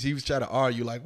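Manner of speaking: usual speaking voice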